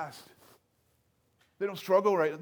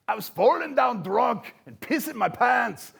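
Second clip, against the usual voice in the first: slow, higher put-on Scottish accent; a playful Craig Ferguson Scottish lilt; Scottish accent impression; 7 semitones higher, 4 dB louder than usual. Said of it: "That's my best accent".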